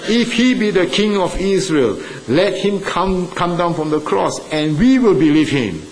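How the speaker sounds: high, scornful challenge; mocking taunt. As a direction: dare him to come down, jeering. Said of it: "they mocked him".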